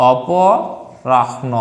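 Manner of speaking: অপরাহ্ন very slowly, opo - rahno; careful dictation. Shown as a demonstration. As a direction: draw out অপরাহ্ন in parts, pausing inside. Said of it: "শব্দটা হচ্ছে".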